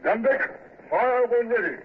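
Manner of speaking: high barked battle order; shouted order. Acted. Ordering guns to fire.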